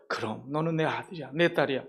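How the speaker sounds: a loving father's quiet answer; tender reply. Acted. God gently assuring his doubting child, soft and tender.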